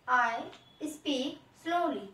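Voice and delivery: drawn out very slow, word by word; slowly. Shown as a demonstration. Teacher shows the children what slowly sounds like, drawn out very slow. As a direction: stretch each word of I speak slowly right out. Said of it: "मैं धीरे धीरे बोलता हूँ".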